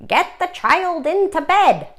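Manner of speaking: little monster answering the teacher; gurgled answer. Acted. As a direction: pipe up eagerly like a small monster.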